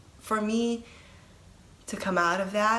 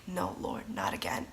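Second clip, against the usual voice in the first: whispered plea; 11 semitones lower, 5 dB quieter than usual. Retold or imitated. Dreading beings returning, pleading.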